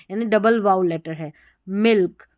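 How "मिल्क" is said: मिल्क with a firm, less short i; loud मिल्क, vowel joined onto the l; vowel not short. Shown as a demonstration. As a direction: lean into the i of मिल्क, running into the l. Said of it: "आई का थोड़ा श-, short नहीं आ रहा है".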